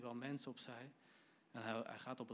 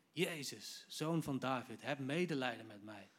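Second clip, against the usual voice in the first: raised, insistent call; imitating a beggar's urgent cry; crying out harder; 4 dB louder than usual. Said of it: "hij riep des te harder".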